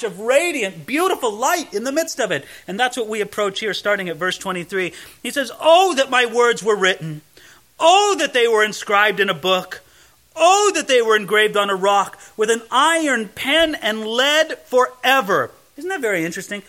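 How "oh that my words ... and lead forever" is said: louder, ringing scripture proclamation; preaching. Acted. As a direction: proclaim it from the pulpit. Preaching the verse at full force.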